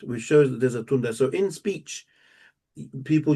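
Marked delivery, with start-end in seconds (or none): none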